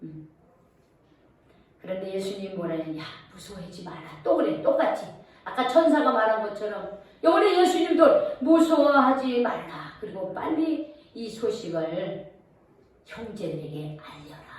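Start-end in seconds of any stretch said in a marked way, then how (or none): 3.0-4.2 hushed, much softer reassurance to frightened women; calm them quietly, like a comforting teacher; gentle reassurance. Acted. Jesus gently calming the kneeling frightened women, hushed reassuring. 8.4-14.5 calm reassurance. Acted. Jesus tells women to spread news.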